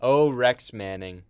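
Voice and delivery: loud, drawn-out, dreamy "Oh, Rex Manning"; mock swoon. Vlogger gushes over the film's pop star in a mock swoon. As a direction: sigh his name like a starstruck fan.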